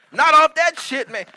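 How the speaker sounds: high, loud shocked protest; shocked refusal. Retold or imitated. Friends refusing the crack pipe, loud and shocked. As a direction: recoil loudly in disbelief.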